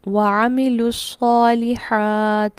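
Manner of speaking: very slow chanted tajweed; Quran recitation. Shown as a demonstration. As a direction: recite slowly and melodically. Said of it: "एक और मिसाल देख लेते हैं".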